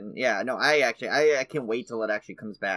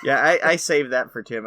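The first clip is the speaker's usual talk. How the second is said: normal talking voice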